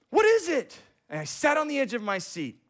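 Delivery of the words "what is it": much higher, louder urgent plea; urgent question. Retold or imitated. Student pleading with the professor, urgent. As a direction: beg for the answer. Speaker's own longing question.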